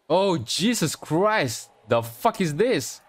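drawn-out, high-pitched shocked exclamation. Real, not acted. Player reacting to the playback, shocked. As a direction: cry out slowly in disbelief.

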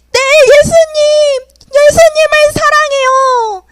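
high, loud, slow emotional reply; tearful answer. Acted. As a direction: answer with heartfelt tears. Peter answers second time.

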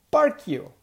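sudden loud, high bark of a line; barked insult. Retold or imitated. Territorial dog snapping at the newcomer, loud. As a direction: spit it out like a snarling dog.

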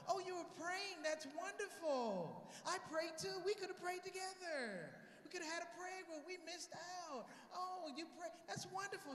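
high, drawn-out gushing lady; overboard gushing. Retold or imitated. Lady passenger thrilled he prays too, hammed-up high gushing. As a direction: imitate a woman overdoing her delight. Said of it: "And she goes overboard".

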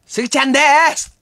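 loud, bright catchphrase yell up high; cheery entrance shout. Retold or imitated. Comedian bursting into the room with his catchphrase. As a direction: burst in announcing yourself.

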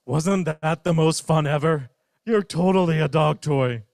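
loud, gleeful; loud happy dog. Acted. Dog brags to Bear after playing, loud and gleeful. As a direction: crow about the fun.

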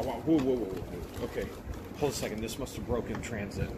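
ordinary talk, nothing marked

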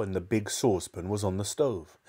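plain reading voice

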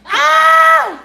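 piercingly high, loud squeal; joyful scream. Real, not acted. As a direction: shriek with joy, tipping into laughter. Screams at sticker.

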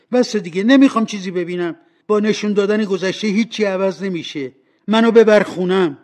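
high, loud outburst; exasperated, forceful demand; desperate protest. Acted. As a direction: cry out to make it stop. Scrooge demands the spirit stop.

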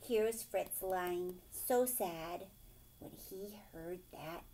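ordinary delivery, nothing marked

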